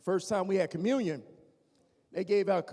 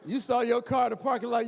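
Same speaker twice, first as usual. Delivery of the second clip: usual speaking voice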